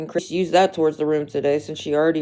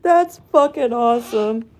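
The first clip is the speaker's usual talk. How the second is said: still giggling; 5 semitones higher than usual. Real, not acted. Laughing at viewer's joke.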